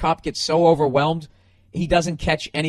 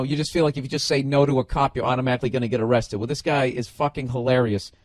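ordinary talk, nothing marked

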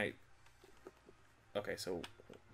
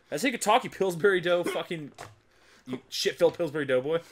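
loud, high mock insult; angry toast voice; 6 semitones higher, 8 dB louder than usual. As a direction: shout insults down at the Doughboy. Voicing toast insulting the Doughboy.

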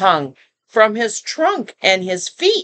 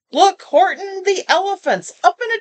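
much higher, jeering sing-song; mock Horton with high teasing glee; gleeful taunting shout; 11 semitones higher than usual. Friends shouting at Horton to mock him, high and gleeful. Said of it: "they shouted with glee".

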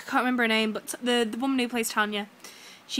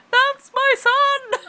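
very high, slow, loud mock shout; 9 dB louder, much slower than usual. Girl in the queue shouting at the press, hammed-up yell. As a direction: belt it out like a fan teasing the star. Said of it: "kept shouting".

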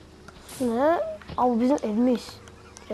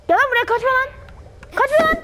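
high loud yelling; chase shout; 9 semitones higher, 4 dB louder than usual. Real, not acted. Chasing the runaway monster, loud high yelling. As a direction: yell after the fleeing enemy.

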